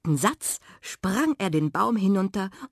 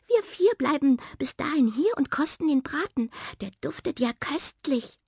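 higher-pitched little mouse voice; Waldmaus, zufrieden; 9 semitones higher than usual. Acted. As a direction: chatter on as a contented mouse. Mouse continues her speech. (hohe, zufriedene Mäusestimme)

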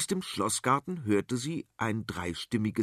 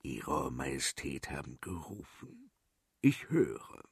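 low, much softer, dark minister; a dark servant's murmur; gloomy minister answering the queen, low and soft; sinister minister; 9 semitones lower, 6 dB quieter than usual. Acted.

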